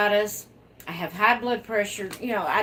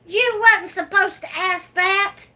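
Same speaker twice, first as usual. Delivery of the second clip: higher and louder mock scold; loud playful scolding; 11 semitones higher, 6 dB louder than usual. Real, not acted. Scolding a nosy viewer in fun, high and loud. Viewer asks about new babies.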